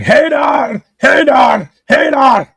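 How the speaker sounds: high, loud repeated holler; mock shouting. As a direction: shout it like a grandpa waking in alarm, hammed up.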